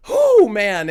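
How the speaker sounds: loud, high whoop of feeling; real emotional outburst; overwhelmed exclamation. Real, not acted. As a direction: exhale it loudly, bowled over. Overcome recalling the scene.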